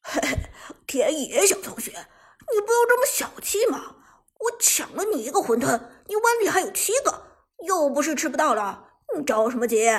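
cheeky, high singsong mockery; chuckle, then throw the words back teasingly; gloating mimicry, loud and swinging high; chuckling taunt. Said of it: "马海龙嘿嘿一笑".

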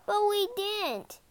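high, drawn-out whining protest; child's protest. Acted.

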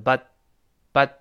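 but said fast, t barely released; quick but. Shown as a demonstration. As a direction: clip but quickly, tongue tip just touching. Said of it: "读快就是".

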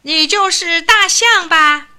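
high, loud, slow haughty call; little mouse calling to the giant elephant, haughty; arrogant shout. A narrator's reading. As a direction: shout up with all his tiny pride. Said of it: "傲慢地喊了起来".

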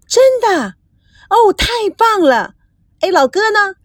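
high-pitched excited squeal; thrilled. Acted. Girl gets great news on the phone, thrilled. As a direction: burst out with delight, voice leaping high.

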